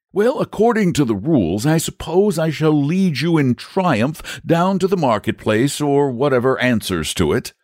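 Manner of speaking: high-pitched knight laying out the plan; explaining knight. Acted. Saint George explains the victory parade, matter-of-factly.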